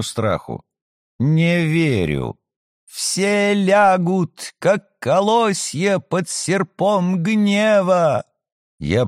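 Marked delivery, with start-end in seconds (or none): 1.2-2.4 eerie prophetic voice. Acted. Possessed woman's ominous prophecy. 2.9-8.2 slow, higher ominous prophecy; eerie prophetic voice. Acted. Possessed woman's ominous prophecy.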